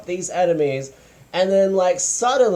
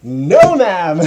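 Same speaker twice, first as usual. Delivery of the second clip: sassy no ma'am; 7 dB louder than usual. Real, not acted. Sassy dismissal of show.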